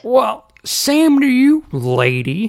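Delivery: high, loud, drawn-out retort; angry uncle. A narrator's reading. Uncle answers the queen's supposed insult, angry retort. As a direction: hurl it back with exaggerated slow indignation. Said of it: "mistaking Uncle Shameless's anger".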